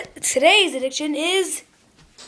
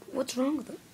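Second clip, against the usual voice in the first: normal talking voice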